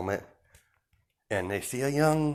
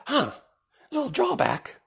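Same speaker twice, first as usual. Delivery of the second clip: menacing villain Deku. Acted. Deku telling the villains they angered his boss, high and taunting. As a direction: lord it over them, savouring each threat.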